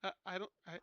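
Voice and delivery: ordinary delivery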